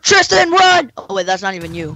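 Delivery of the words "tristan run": high, loud warning; urgent shout. Real, not acted. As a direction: yell a warning. Warning teammate of attacker.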